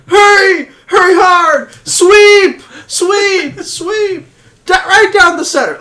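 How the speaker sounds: high, loud yells of a curling skip; curling skip yelling. Retold or imitated. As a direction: bellow sweep commands down the ice. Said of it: "I had a bit that I wrote".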